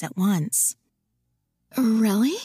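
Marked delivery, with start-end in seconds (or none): 1.8-2.4 eager. Acted. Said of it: "She cursed the desperate enthusiasm in her voice".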